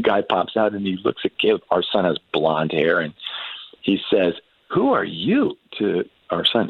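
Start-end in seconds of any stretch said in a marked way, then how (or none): 4.7-5.6 high, rising startled question; a stunned soldier calling down from the tank; surprised soldier. Retold or imitated. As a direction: pitch jumps high on WHO as a startled, lightly voiced question.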